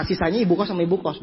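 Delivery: usual speaking voice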